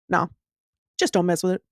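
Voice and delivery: a hint of a stoic kid from the swamp, deadpan; mild imitation of an emotionless eight-year-old boy; Southern swamp boy. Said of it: "impression of this boy".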